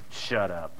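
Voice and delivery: low, soft growling threat; a drunk home fan cutting off the rival fan; menacing shutdown. Acted. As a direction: shut him down, low and menacing. Drunk fan silences you.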